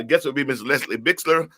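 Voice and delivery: ordinary delivery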